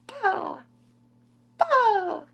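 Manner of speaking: very high playful sound effect; high mock pow. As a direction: squeak out the kicks. Imitating cat's kick.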